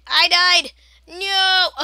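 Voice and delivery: loud dismayed yell. Real, not acted. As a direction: shout it out in real dismay. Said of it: "If I'm yelling too much".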